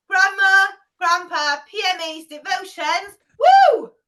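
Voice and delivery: loud, building to a high woo; rally the group into cheering; excited cheer. Shown as a demonstration. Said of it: "you're all gonna woo".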